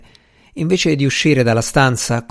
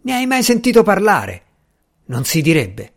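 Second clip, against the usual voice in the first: irritated, higher start that drops away; angry sarcasm; 6 semitones higher than usual. A narrator's reading. Newly arrived visitor taunts his host, angrily sarcastic. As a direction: needle him with a scornful question. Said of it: "risposi con rabbia".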